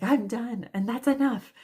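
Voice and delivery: mock-furious, putting her foot down; fed-up declaration. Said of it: "so mad".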